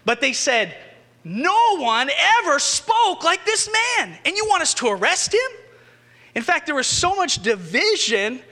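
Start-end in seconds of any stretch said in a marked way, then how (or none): 1.2-4.2 high, slow, drawn-out exclamation; exclaimed in awe. Acted. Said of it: "I don't know if they were yelling it". 4.2-5.5 high, fast, disbelieving question; incredulous exclamation. Acted. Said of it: "I don't know if they were excited about it".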